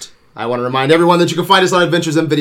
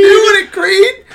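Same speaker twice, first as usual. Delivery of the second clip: high, loud tearful cheer; cheering shout; 8 dB louder than usual. Retold or imitated. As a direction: yell at the screen, choked up.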